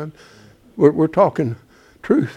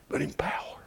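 hushed, reverent whisper; whispered "but in power"; awed whisper; 15 dB quieter than usual. Acted. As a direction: breathe it like a holy secret.

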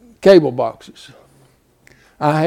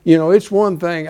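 ordinary talk

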